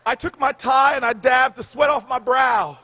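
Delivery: usual speaking voice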